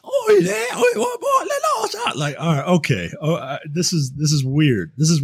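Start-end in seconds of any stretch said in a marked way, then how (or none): 0.0-2.2 mock English urchin cry, pitched very high; hammed-up, squealing British accent imitation; bad Oliver Twist British. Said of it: "like a bad version of Oliver Twist".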